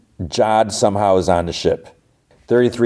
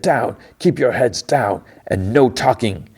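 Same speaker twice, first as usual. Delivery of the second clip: slow, higher imitated command; stern order; 5 semitones higher, slower than usual. Jod to the whimpering kids, stern command. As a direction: lay down the law, word by word.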